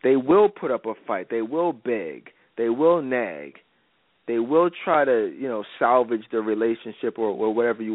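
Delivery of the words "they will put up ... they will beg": ordinary delivery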